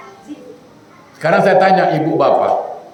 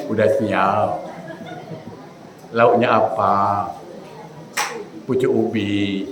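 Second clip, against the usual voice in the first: soft, low and slow husband-and-wife exchange; gentle slow dialogue; 8 semitones lower, 8 dB quieter, slower than usual. Shown as a demonstration. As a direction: speak tenderly, stretching the words. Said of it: "Tapi pakai tajwid dia".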